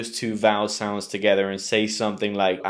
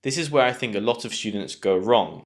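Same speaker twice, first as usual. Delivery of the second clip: normal talking voice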